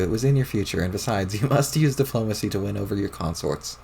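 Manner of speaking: usual speaking voice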